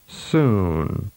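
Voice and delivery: "Soon." with the oo held long, falling; drawn-out "Soon."; long vowel. Shown as a demonstration. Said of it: "and then with a longer duration".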